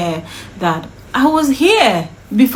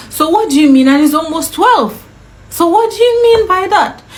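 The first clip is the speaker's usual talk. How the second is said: re-enacted indignant questioning, pitched up; heated, higher-pitched comeback; angry challenge; 6 semitones higher, 3 dB louder than usual. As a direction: demand an explanation in exasperation. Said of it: "I'm angry because".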